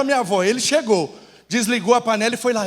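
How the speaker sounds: ordinary talk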